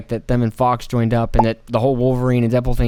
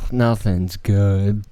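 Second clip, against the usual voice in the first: jaded drone; 5 semitones lower, much slower than usual. Retold or imitated. Jaded voice quoted.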